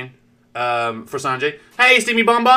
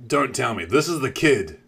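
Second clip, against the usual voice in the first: ordinary talk, nothing marked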